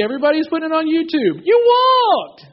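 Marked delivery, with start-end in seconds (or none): 1.5-2.5 put-on very high, loud cheer to a toddler; delighted parent cheer. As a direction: cheer the baby's first steps. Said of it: "There's like a party".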